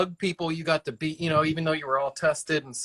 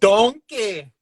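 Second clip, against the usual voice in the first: loud, higher mock Scottish call of "Donkey"; Shrek's Scottish shout; 6 semitones higher, 6 dB louder than usual. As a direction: bellow "Donkey" like Shrek in a put-on Scottish accent. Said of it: "what is your best Scottish accent".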